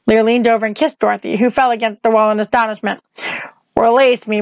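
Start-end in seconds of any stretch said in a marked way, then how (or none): none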